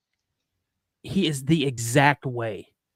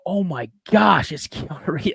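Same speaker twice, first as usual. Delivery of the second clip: starstruck fanboying; 4 semitones higher, 3 dB louder than usual. Retold or imitated. Telling friends how he met his movie idol, fanboying. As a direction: gush like a retold fan moment. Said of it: "I'm fanboying".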